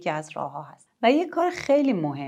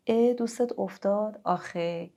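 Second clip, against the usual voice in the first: a hint of concern, higher on the question; sympathetic reaction; 4 semitones higher, slower than usual. Shown as a demonstration. Mother reacting to son's story with sympathy. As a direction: show the child you heard.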